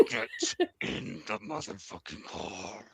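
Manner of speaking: low, slow, menacing mock mom; quietly seething mom ordering her kid into the car; retold furious mom. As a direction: growl it low like a furious mother. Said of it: "she went".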